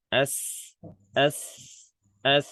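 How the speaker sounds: "As" ending in a long hissed s, air pushed out between the teeth; a teacher drilling the letter sin with sukun; hissed s sound. Shown as a demonstration. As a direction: sound the s of "As" as a steady hiss through the gaps in the teeth. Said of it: "cuman dia nekal sini nih".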